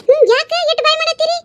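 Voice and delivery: high scolding elder; elder woman. Acted. Elder woman asking the quarrelling women why they shout, high.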